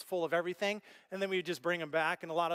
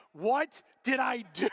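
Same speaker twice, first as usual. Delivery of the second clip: higher, louder, slow comic wail; mock despair; 5 semitones higher, 5 dB louder, slower than usual. Walking into his empty garage, in hammed-up dismay.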